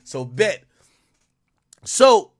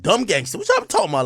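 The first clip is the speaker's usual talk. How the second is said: usual speaking voice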